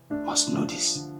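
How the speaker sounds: whispered warning; father hushing his daughter about the secret. Acted. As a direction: breathe the secret low.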